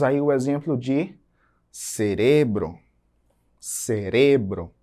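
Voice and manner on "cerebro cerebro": "cerebro" very slow and lower, stressed on RE; drawn-out Spanish "cerebro", ce-RE-bro; stress on middle syllable. Shown as a demonstration. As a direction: lean on the re in "cerebro". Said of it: "a sílaba mais forte está em re".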